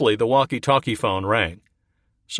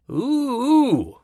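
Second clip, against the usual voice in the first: high teasing hoots, much higher; mocking ooh; 3 dB louder than usual. Private mocks Swinging Bill with a teasing ooh. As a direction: razz him with a mock ooh.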